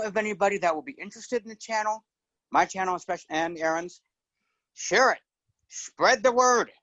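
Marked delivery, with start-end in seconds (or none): 4.7-6.7 rallying call. Real, not acted. Guest urges listeners to share.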